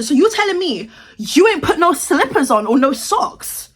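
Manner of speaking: usual speaking voice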